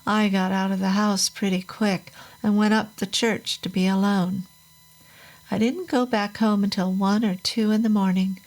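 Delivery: ordinary delivery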